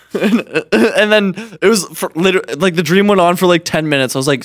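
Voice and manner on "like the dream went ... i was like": ordinary delivery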